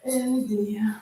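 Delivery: whispering. Real, not acted. Aside while fixing camera.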